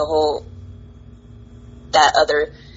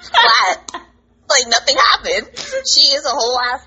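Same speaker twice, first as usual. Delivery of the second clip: laughing; 7 semitones higher than usual. Real, not acted. Joking about rival.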